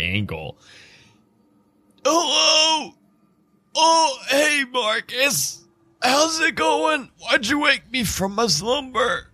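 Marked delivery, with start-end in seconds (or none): 2.0-9.3 very high, put-on ancient vampire voice; decrepit old vampire. Ancient vampire greets Marcus after being woken, high decrepit voice. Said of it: "decrepit looking vampire with a walking stick".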